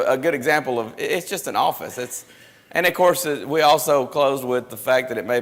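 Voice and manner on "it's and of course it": usual speaking voice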